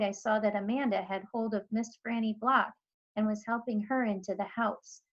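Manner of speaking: ordinary delivery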